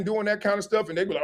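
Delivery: usual speaking voice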